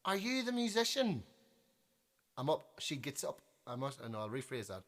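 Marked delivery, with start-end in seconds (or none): 0.0-1.2 high-pitched mock lady's question; pushy lady. Lady walks up to the musician on stage, high mock voice. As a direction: imitate a pushy punter, pitched way up and falling at the end. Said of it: "this lady walks in".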